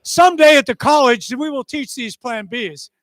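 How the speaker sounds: high, lightly voiced mock academic; a hint of pompous college instructor; teasing mock voice; mocking imitation. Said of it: "They go".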